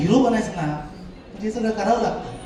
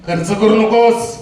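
loud, slow warning from a father; a raised-voice parental command; stern father; 5 dB louder, much slower than usual. Acted. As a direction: imitate a father ordering his son. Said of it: "शंभर रुपये ठेवले आणि म्हणाला".

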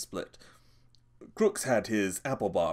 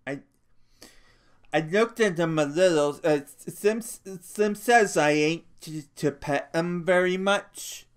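put-on higher, halting childlike voice with stammers; anxious to please, tripping over words; simple-minded big man; 7 semitones higher, 4 dB louder than usual. A narrator's reading. Lennie telling Crooks why he came, nervous and halting.

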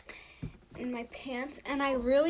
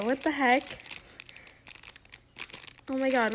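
normal talking voice

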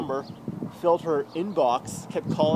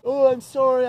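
higher, louder hammed-up sorry; mock crying apology; 8 semitones higher, 9 dB louder than usual. Voicing the apology his ex should give, mock tearful. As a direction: fake a tearful sorry, mockingly. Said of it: "She should be crying".

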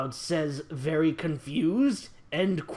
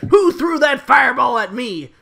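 angry shout; 4 semitones higher, 7 dB louder than usual. Acted. Fireball hits Cloud.

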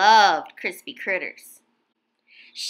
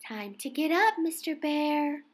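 softer coaxing call; gentle wake-up; 3 semitones higher, 7 dB quieter than usual. A narrator's reading. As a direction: coax him awake softly. Gently waking sleeping bear.

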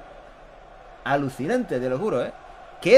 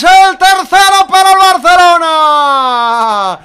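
long stretched goal shout; spanish goal commentary; 11 semitones higher, 10 dB louder, much slower than usual. Real, not acted. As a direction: belt the goal call out, drawn out.

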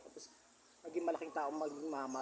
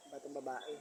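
ordinary talk

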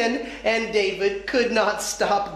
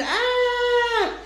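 high, sustained storybook scream; light high-pitched held scream; mock scream; 14 semitones higher than usual. A narrator's reading. Little kids see the racing gecko, high mock scream. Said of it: "Little kids screamed".

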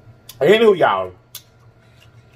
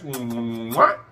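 blown kiss; 3 semitones lower, 4 dB quieter than usual. Real, not acted. Blowing kisses to viewers.

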